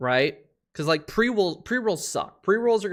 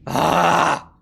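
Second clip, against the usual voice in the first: screaming much higher and louder, dragged out; furious shout; 11 semitones higher, 10 dB louder than usual. Real, not acted. Streamer screaming at the enemy ship's pilot, furious shout. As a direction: roar your rage at the enemy ship. Raging at enemy ship in game.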